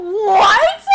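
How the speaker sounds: sudden high, loud what; jolted by a chat message; surprised squeal. Real, not acted. Streamer shrieks at chat, surprised.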